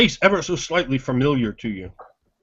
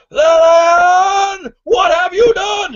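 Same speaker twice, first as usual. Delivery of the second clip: very high, loud crying shout; anguished yell; 9 dB louder, much slower than usual. Acted. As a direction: scream in horror at the killer. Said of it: "and he yells".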